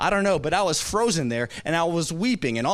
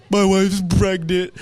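slow, louder, hammed-up choke; mock tearful confession; 3 semitones higher, 6 dB louder than usual. Husband telling the shop lady his news, mock tearful. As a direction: squeeze the news out as if about to bawl.

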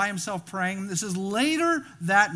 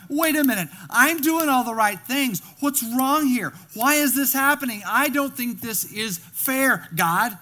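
higher, louder protest at God; complaining to God; 4 semitones higher, 6 dB louder than usual. Pretend. Believer under strain protesting to God, aggrieved. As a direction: push back at God, feeling it's unfair. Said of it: "it's an easy thing to do is say".

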